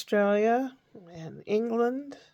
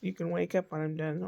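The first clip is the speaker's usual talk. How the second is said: soft even monotone; calm monotone lulling a child; 3 semitones lower, 6 dB quieter than usual. Shown as a demonstration. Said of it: "nice monotone voice like I do here".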